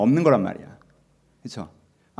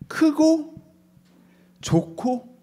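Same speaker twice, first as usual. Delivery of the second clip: high, wide-eyed big and good; childlike awe; 11 semitones higher, much slower than usual. Acted. Preacher describing how a five-year-old sees dad, high and awed.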